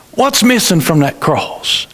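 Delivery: usual speaking voice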